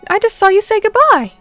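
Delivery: ordinary talk, nothing marked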